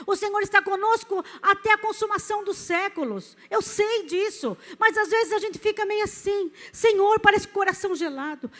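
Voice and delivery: impassioned preaching. Real, not acted. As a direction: preach it fervently, swelling to a ringing declaration. Preaches with rising force.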